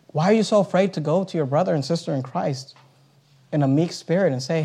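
ordinary talk, nothing marked

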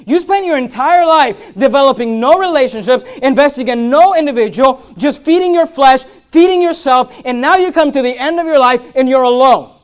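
loud, high, driving sermon voice; loud rebuke from the pulpit; full-force preaching. Acted. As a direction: preach it hard at the room.

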